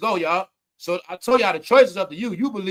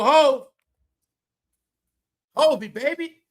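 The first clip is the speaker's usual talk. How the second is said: lightly imitated fond call, pitched up; higher, teasing imitation of someone calling; mock calling out; 6 semitones higher, 5 dB louder, slower than usual. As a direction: call out to your baby in mock affection. Imitates people calling to [name].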